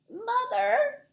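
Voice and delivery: put-on high tiny squeak of a lost baby; squeaky baby bat. A narrator's reading. Lost baby bat calling for her mother, high squeak. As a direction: squeak it out, small and scared. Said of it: "Stellaluna squeaked".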